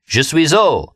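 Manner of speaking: high, eager tipsy exclamation; cried out. A narrator's reading. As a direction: blurt the French out proudly. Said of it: "I cried".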